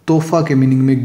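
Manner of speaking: usual speaking voice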